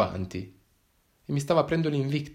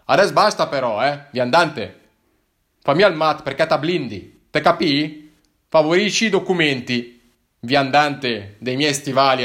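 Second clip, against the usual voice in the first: exasperated cop; 2 semitones higher, 7 dB louder than usual. Acted. Policeman orders the wanderer to hand over documents, exasperated. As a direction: cut him off, fed up.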